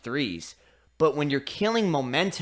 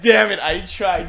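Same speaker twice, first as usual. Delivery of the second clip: imitating referee; 3 semitones higher, 8 dB louder than usual. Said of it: "he's like".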